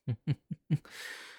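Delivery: high, light mocking chuckle; the king laughing as his prey bolts; amused laugh. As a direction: laugh at her attempt to run. Captive tries to flee.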